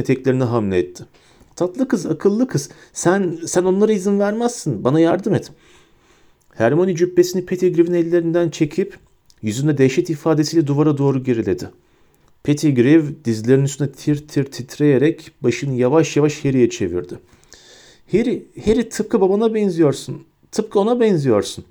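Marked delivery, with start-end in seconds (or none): 1.6-5.5 high, wheedling rat-man voice; cringing Pettigrew plea. A narrator's reading. Pettigrew grovels to Hermione, high wheedling plea. 18.1-21.6 high, wheedling rat-man voice; cringing Pettigrew plea. A narrator's reading. Pettigrew grovels to Hermione, high wheedling plea.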